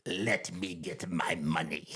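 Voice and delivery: slow, soft imitation of the Emperor; creepy Emperor, hushed and drawn out; Palpatine voice. Palpatine paying at the counter, slow soft Emperor voice. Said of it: "staying in full character".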